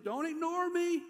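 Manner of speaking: high, loud protest; demanding. Acted. David telling God not to ignore him, demanding. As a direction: cry it out as an insistent demand. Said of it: "he's demanding God to do something".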